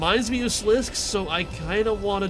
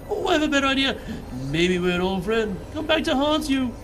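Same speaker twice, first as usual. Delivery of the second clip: high spooky taunt; haunt them in a high voice; ghostly voice; 6 semitones higher than usual. Acted. Shadow voice in head.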